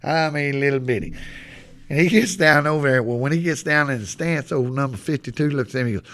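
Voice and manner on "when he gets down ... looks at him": ordinary delivery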